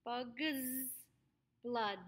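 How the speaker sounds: BUG'S drawn out to a buzzed end, BLOOD with a released D; careful consonants. Shown as a demonstration. Coach shows the sounds, BUG'S BLOOD carefully articulated. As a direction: separate BUG'S and BLOOD, finishing each. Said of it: "make sure you get that k sound".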